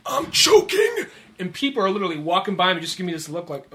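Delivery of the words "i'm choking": high, held-back mock yell; strained yell. Host re-enacts choking outside Safeway, strained high yell. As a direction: cry for help without fully yelling. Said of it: "I yell out".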